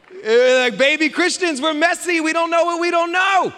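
loud, high preacher's cry; preaching shout. Real, not acted. Pastor shouting to the congregation about messy baby Christians. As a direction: belt it out to the congregation.

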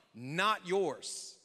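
usual speaking voice

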